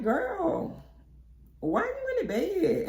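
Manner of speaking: high, put-on voice of disbelief; incredulous girlfriend. Teasing a friend still in bed, high disbelief. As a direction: squeal it at your friend, half laughing. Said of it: "And I'm like".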